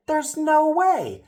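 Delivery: very high, loud, drawn-out disbelief; incredulous. Retold or imitated. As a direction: imitate someone who thinks it's insane, slowly and loudly. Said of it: "looking at me like I'm insane".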